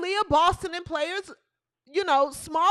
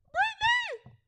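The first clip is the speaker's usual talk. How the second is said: falsetto name call; 4 dB quieter than usual. Acted.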